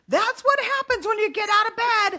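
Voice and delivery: high, loud mock scolding; full lecture mode. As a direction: scold a child like a fed-up parent, hammed up. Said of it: "I'm totally in full lecture mode".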